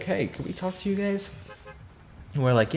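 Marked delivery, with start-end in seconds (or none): 0.0-1.3 lightly voiced police officer. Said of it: "And they're like".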